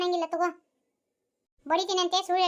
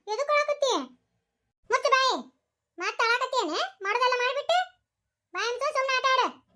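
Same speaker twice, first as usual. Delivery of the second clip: put-on scolding the child; 7 semitones higher than usual. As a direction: snap at the crying child to hush.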